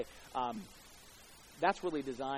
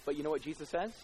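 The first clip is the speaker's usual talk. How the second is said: usual speaking voice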